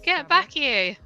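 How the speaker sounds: re-enacted shout after someone; calling out. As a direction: call someone back as they leave. Calling back someone leaving early.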